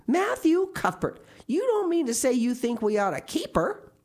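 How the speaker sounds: high, loud, incredulous outburst; astonished. A narrator's reading. Marilla learns Matthew might keep the girl, astonished. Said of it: "Marilla's astonishment could not have been greater".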